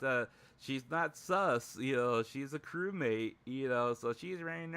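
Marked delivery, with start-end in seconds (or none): none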